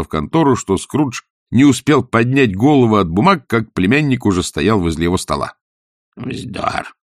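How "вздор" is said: grumbling. A narrator's reading. Scrooge dismissing his nephew's greeting, grumbling. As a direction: grumble it under your breath. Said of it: "проворчал Скрудж".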